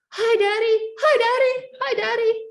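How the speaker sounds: put-on high, loud, excited little-boy shout; child shouting into phone. Three-year-old son yelling to his dad on the phone, shouting. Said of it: "He started shouting into the phone".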